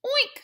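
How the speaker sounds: very high squeaky oink; a bright happy grunt; pig oink. A narrator's reading. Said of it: "said Mercy".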